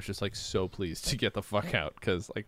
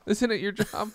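mock outraged juror; 11 semitones higher, 7 dB louder than usual. Playing a clueless angry juror.

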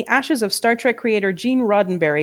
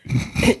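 short, low snicker; snickering laugh; 9 semitones lower than usual. Real, not acted. Co-host giggles at Uranus mid-read, short snicker. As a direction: let a brief snicker slip out.

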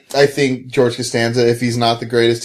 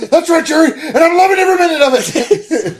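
sitcom character outburst. Acted. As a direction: shout it like a manic sitcom character. Acts out Seinfeld quote.

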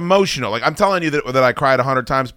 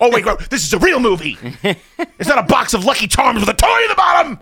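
higher, louder, laughing through the rant; ranting at himself, laughing, higher and louder; laughing mock rant; 8 semitones higher, 3 dB louder than usual. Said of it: "I'm like".

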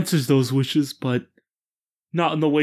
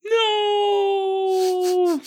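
very high wailing shout; hammed-up anguished no; a mock despairing cry at the bad news; dramatic cry. Reacting to deed being gone.

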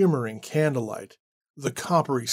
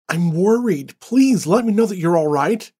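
worried pleading; 4 semitones higher, 5 dB louder than usual. Acted. Annie pleads on voicemail.

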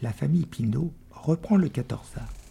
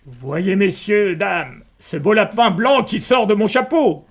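higher, louder fairground patter; showman barker; 6 semitones higher, 7 dB louder, slower than usual. Acted. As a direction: sell the trick to the crowd. Magician presents rabbit trick.

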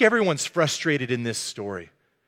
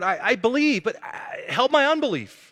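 raised, higher-pitched desperate cry; a father's acted outburst; great shout; 6 semitones higher than usual. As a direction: cry out, stumbling, then plead higher. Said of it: "the father gave a great shout".

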